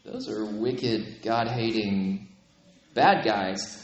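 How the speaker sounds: voz grave, lenta y más suave, sin llegar a susurrar; mock sinister judgment. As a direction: say it slowly and gravely, as if passing sentence. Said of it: "we're like".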